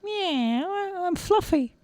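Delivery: high, soft meow; high-pitched casual kitty; friendly cat. Acted. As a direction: introduce yourself as a cartoon cat. Cat introduces itself.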